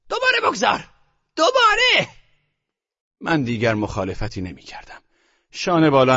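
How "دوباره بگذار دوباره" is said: put-on much higher, louder old woman shouting; grandmother's shout. A narrator's reading. Grandmother shouting at her grandson to bet again. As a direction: demand another bet at the top of her voice. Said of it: "فریاد زد".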